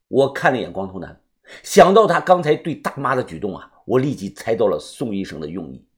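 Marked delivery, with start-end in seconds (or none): none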